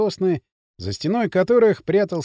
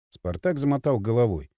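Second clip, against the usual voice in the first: plain reading voice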